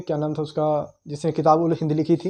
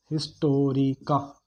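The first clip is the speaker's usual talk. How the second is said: Historica spoken slowly, syllable by syllable; slow dictation; 2 semitones lower, 4 dB quieter, much slower than usual. Shown as a demonstration. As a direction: spell out Historica by sound for note-takers, with small gaps. पूछकर नाम धीरे दोहराना. (Historica को धीरे-धीरे, अक्षर तोड़कर बोलना)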